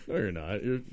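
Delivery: ordinary talk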